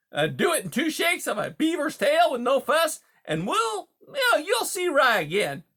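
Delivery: high, loud huckster patter; brash, pushy beaver. Acted. Beaver presses the mice to move for his dam, brash and pushy. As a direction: sell the scheme hard, breezy and loud.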